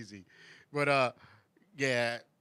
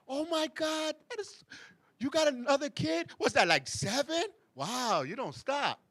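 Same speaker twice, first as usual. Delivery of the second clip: much higher, gasping fake amazement; mock shock; 10 semitones higher than usual. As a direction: act stunned at the news, half joking. Mock shocked comment on another kid.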